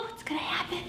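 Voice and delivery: hushed suspense whisper. Acted. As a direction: whisper to the children, teasing.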